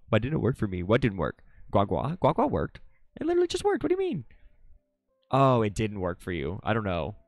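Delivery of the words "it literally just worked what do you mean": surprised. Real, not acted. Surprised it worked.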